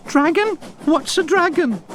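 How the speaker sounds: two chattering carriages asking, baffled; puzzled carriages. A narrator's reading. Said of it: "asked Annie and Clarabel".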